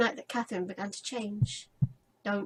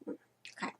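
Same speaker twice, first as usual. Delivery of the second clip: whispered; 12 dB quieter than usual. Real, not acted. Whispered to self.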